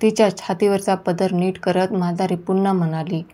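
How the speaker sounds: plain reading voice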